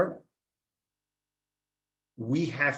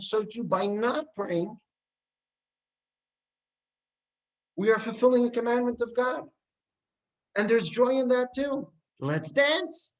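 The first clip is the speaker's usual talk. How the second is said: joyful call to dance; 9 semitones higher than usual. Retold or imitated. Zusha's joyful teaching to brother.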